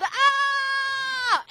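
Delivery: high loud frightened shriek; yelled ah. Acted. Big man scared by Lance's face, high loud shriek. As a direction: shriek and topple over. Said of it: "The man yelled".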